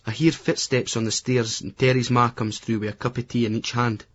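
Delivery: ordinary delivery, nothing marked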